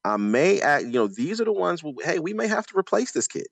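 usual speaking voice

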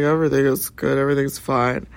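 lightly voiced young man, calm; lightly imitated young man answering; male voice. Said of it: "I hear this male voice".

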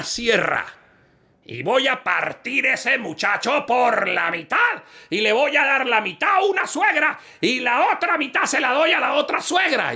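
high, slow shouted ruling; judge's ruling. Acted. The judge orders a saw, high and slow. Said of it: "judge orders".

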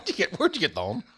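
famous film line in a hammed-up Belfast accent; local Northern Irish accent. Playing the movie-lines-from-here game, broad local accent. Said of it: "but they're from here".

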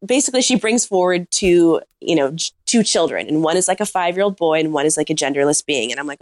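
ordinary talk

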